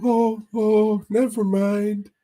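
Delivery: squeaky goofball ordering food; Mahoney impression. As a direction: ham up your buddy ordering, way up high. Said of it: "He's like".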